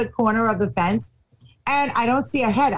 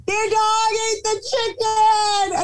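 mock crying kids; 10 semitones higher, slower than usual.

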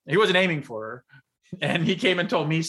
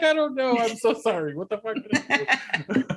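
much higher, flustered, laughing protest; mock bewildered apology; 8 semitones higher than usual. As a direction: act out baffled innocence. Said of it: "And I was like".

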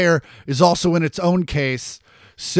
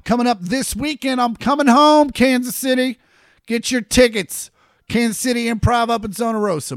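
higher, upbeat ad read; promo plug; 6 semitones higher than usual. Real, not acted. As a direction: hype the hometown show. Promoting tour dates.

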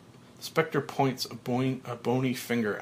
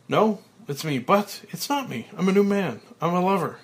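high, loud elated declaration; joyful exclamation; 7 semitones higher, 6 dB louder than usual. Acted. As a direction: announce it with glee. Frank joyful, a new man.

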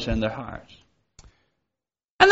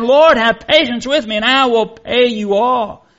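pleading servant; 6 semitones higher than usual. Acted. Servant begs his lord.

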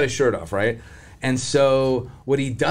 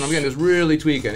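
usual speaking voice

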